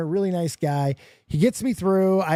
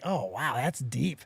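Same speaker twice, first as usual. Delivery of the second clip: hushed awe; 7 semitones lower, 7 dB quieter, slower than usual. Retold or imitated. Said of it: "I was like".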